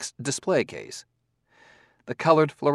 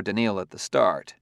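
ordinary delivery

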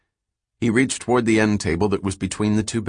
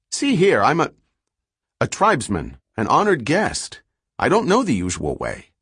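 higher and louder, fed-up insistence; exasperated; 6 semitones higher, 4 dB louder than usual. A narrator's reading. Selden losing patience with the unhelpful clerk on the speaker, exasperated. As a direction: push back hard at the clerk.

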